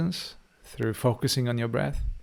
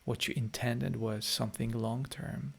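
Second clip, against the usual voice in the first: guided meditation; 9 dB quieter than usual. Acted. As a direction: gently ease the listeners back into their bodies. Leads a closing guided meditation.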